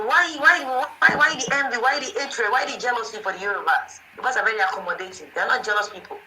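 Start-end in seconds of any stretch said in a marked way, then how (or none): 0.0-4.0 raised, heated repeated why, pitch climbing high then settling; indignant why. Real, not acted. Angry caller demands answers.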